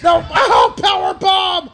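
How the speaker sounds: high-pitched climactic match call; real ringside frenzy; excited shouting. Real, not acted. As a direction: yell the finishing move and count. Calling the finishing power bomb.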